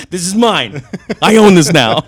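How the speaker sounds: high, loud, laughing claim; laughing mock gloat. To the cyclist, a laughing loud gloat over his bike.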